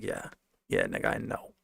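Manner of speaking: low, breathy, smug "Yeah, yeah"; mock cocky brag. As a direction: shrug off the compliment like it's obvious. Mock cocky reply to praise.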